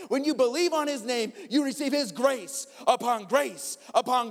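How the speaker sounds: high, loud preacher's cry; preaching at full force. Acted. Pastor proclaiming grace to his congregation, fervent preaching. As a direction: preach it out to the room, slowing on grace.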